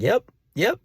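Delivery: squeaky, awkward agreement; tiny high mock reply; high-pitched yep. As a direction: say it way up high, playing uncomfortable. Retold awkward answer to hinting friend.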